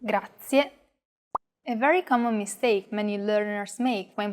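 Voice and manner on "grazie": Grazie with a clear final E; Italian grazie. Shown as a demonstration. Teacher models Grazie, careful Italian pronunciation. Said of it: "Listen closely to how I pronounce this word".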